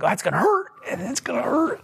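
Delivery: high, whimpering fear of pain; mock dread. Believer fearing God will take things away, high mock whimper.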